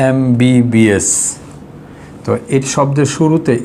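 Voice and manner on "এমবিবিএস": এমবিবিএস said slowly letter by letter, starting with an em sound; slow and careful, এমবিবিএস sounded out; spelled out slowly. Shown as a demonstration. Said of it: "এটা একটা শব্দ সংক্ষেপ". (এমবিবিএস ধীরে ধীরে, প্রতিটি অক্ষর আলাদা করে)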